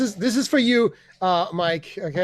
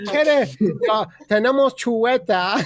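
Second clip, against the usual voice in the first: mock Chinese-accented Spanish. Waiter lists what they have, mock Chinese accent. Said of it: "in Spanish with a Chinese accent".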